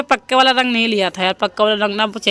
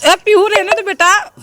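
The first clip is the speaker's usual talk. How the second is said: high, loud call across the roof; 11 semitones higher, 6 dB louder than usual. Real, not acted. Mother calls daughter Pihu to stop, high loud call.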